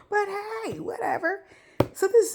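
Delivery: talking through hiccups. Real, not acted. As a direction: hiccup breaks the words. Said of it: "you will have to forgive my hiccups".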